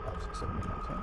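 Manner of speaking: soft breathy whisper, counting under the breath. Real, not acted. Player hiding from a monster, whispering the count. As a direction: count in a hush so nothing hears you.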